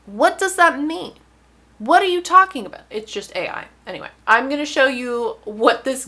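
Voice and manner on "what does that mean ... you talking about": exasperated. Real, not acted. Exasperated reaction to the scam email.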